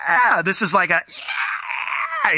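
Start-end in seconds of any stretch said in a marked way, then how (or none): 0.1-0.4 high-pitched concert "yeah"; concert cheer. Shown as a demonstration. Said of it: "like in a concert where you're like". 1.1-2.3 high, held-back scream; mock blood-curdling scream. Shown as a demonstration. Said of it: "it's just a blood-curdling scream".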